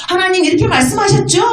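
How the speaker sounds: high-pitched pleading prayer; acted crying out. Believer holding God to his word, acted crying out. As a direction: call up to God, voice lifted high. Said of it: "나한테 와서 부르짖어야지".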